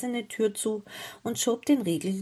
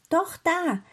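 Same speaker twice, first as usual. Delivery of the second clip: high-pitched cry of discovery; excited little girl spotting something; 9 semitones higher, 3 dB louder than usual. A narrator's reading. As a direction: point it out eagerly, voice jumping up. Gretel spots a swan.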